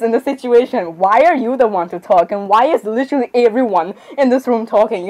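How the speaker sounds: ordinary delivery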